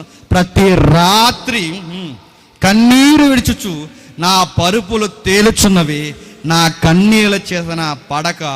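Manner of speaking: loud, slow, weighty psalm reading; solemn scripture reading. Shown as a demonstration. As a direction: read it slowly, lamenting. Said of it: "ఏమంటున్నాడు చూడండి".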